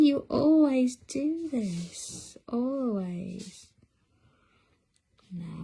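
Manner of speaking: normal talking voice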